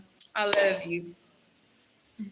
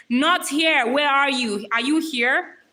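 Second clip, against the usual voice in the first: loud roll-call shout; loud, searching call; 2 semitones higher, 7 dB louder, slower than usual. Real, not acted. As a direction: call out for someone who may be absent. Calling roll for an absent viewer.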